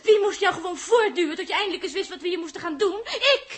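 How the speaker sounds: loud accusations with bitter laughter; exasperated shouting; furious accusation. Acted. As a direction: jab him with each Ik. Quarrel continues.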